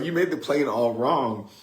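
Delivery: milder mock kid complaint; lightly voiced, slightly high accusing little sister. Same quote repeated after laughing.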